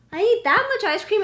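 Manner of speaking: high, loud disbelieving squeal; shocked disbelief. Retold or imitated. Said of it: "And I'm like".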